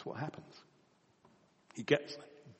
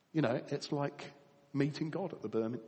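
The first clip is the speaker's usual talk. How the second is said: ordinary talk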